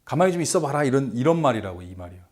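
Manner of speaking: ordinary talk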